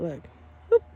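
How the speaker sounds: ordinary talk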